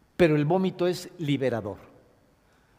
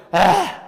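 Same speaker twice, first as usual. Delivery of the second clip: loud forced heaving ah; retching groan; 2 semitones higher, 8 dB louder than usual. Shown as a demonstration. Teacher acting out crying like vomiting, loud heaving ah. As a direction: heave it out with effort.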